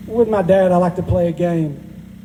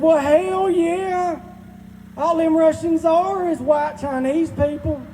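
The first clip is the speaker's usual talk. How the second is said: much higher, slow drawling dad from the background; imitating dad; 8 semitones higher, slower than usual. Dad cutting in on the speakerphone, imitated much higher and slower. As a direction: ham up dad shouting in over the speakerphone. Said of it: "my dad from the background said".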